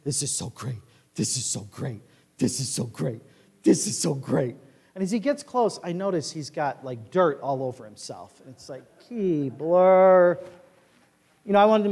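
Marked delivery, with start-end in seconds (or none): 0.0-4.6 low, hushed panting dog chant; delighted dog. Acted. Dog running to his owner, delighted. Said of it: "he's just delighted". 9.1-10.4 name drawn out in dismay; dismayed scolding. Acted. Owner sees his dog covered in dirt, dismayed.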